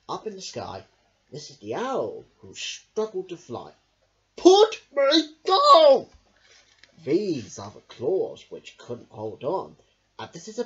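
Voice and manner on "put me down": very high, loud, slow shout; squeaky panicked bear. Acted. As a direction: yell to be let go. Bear carried by owl.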